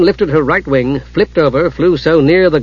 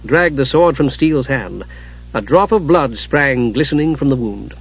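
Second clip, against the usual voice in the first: ordinary delivery, nothing marked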